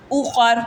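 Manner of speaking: اوخر with a thick vowelless r at the end; stopped, heavy r. Shown as a demonstration. Teacher repeats for the class, اوخر with heavy stopped r. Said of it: "موٹی پڑھی جائے گی".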